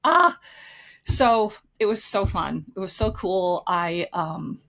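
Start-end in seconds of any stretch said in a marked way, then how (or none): 0.0-0.4 high joyful scream of shock; retold scream. Mother-to-be shrieking at grandparent news, loud and high. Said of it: "I was like".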